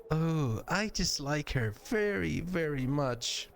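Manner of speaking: high little voice, unconvincingly stern; sweet character badly faking dislike; exaggerated mock disapproval. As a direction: draw out the last words in feeble pretend scorn. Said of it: "frowns deeply, almost exaggeratedly".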